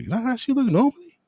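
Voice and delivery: high-pitched, rushed question; incredulous. Real, not acted. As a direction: ask it fast in high-pitched disbelief. Reacting in disbelief to photo.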